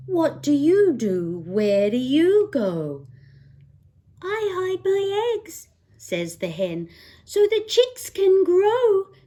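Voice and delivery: lively picture-book read-aloud; singsong bedtime book voice; reading to children. Acted. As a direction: read it like storytime for a little one.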